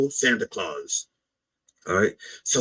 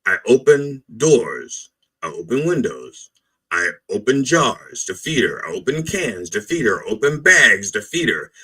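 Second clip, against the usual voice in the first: normal talking voice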